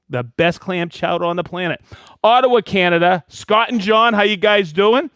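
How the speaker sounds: normal talking voice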